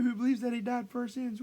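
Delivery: high, mock crowd-rousing call; rallying call. Leader asking believers about Christ, high acted rallying call. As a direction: play the showman polling a crowd.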